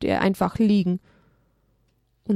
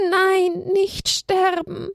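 high, slow, sobbing no; drawn-out crying plea, high and strained; 10 semitones higher, much slower than usual. Acted. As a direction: plead through sobs for him not to die. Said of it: "schluchzte Haberle immer wieder".

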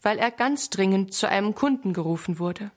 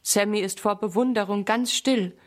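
plain reading voice

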